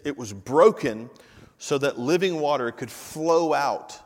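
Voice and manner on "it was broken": "broken" leaned on, a touch louder; stress on broken. Shown as a demonstration. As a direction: drive home the word "broken". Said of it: "I want you to get this".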